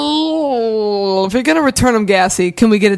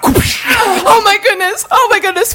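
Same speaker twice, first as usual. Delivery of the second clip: shocked gasp; 11 semitones higher, slower than usual. Acted. Mom shocked by son's shout.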